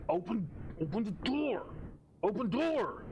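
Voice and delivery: high, pleading monster cry; creature yelling. Acted. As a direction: beg desperately to be let out. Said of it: "and going".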